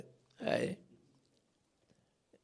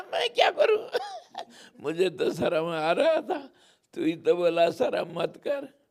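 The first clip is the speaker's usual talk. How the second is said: high, laughing, louder protest; 5 semitones higher, 4 dB louder than usual. Acted. Kanha laughs and blames Yashoda, high and loud. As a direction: laugh while blaming mother.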